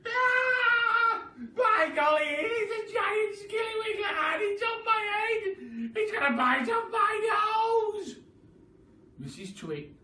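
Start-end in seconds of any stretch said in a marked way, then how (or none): 0.1-8.2 very high, loud frantic shriek; loud terrified hag voice; panicked scream. Acted. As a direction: scream as if it's on your head. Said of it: "So she screamed again".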